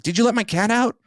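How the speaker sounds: high, suspicious imitation of her; accusing girlfriend. Boyfriend imitating his date accusing him over the cat, high and suspicious. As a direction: pitch up and press the accusation.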